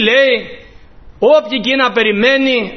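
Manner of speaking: usual speaking voice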